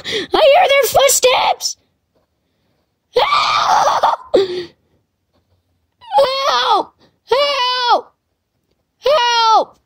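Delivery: high-pitched squeals for help; panicked cries. Real, not acted. Player hearing footsteps behind, panicked high cries. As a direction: squeal for help while being hunted. Calling for help while chased.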